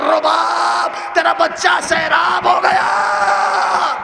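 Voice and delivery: slow, high, shouted sob-laden line; a father's weeping cry to his wife. Retold or imitated. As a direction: break down as you call the wife's name. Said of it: "آخر میں رو کے کہا".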